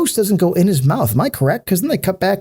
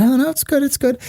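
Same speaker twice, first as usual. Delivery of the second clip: mock dismissive boyfriend; 7 semitones higher than usual. Drunk boyfriend brushing off girlfriend.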